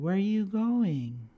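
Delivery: high, drawn-out creepy question; eerie little girl. Retold or imitated. Strange girl turning to the dad, eerie.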